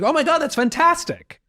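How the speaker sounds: high, loud, put-on gushing squeal; gushing delight. To a partner who asked for a reaction, a hammed-up high gush. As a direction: squeal it like praise you were asked for. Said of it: "I'm like".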